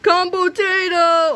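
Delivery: slow, high monster-name reveal; dramatic announcement. Acted. Villain unleashes his ultimate weapon, slow high dramatic reveal. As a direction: unveil the weapon slowly.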